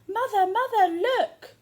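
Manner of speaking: high, loud calling out; excited shout. A narrator's reading. Jack running home to his mother, shouting high and loud.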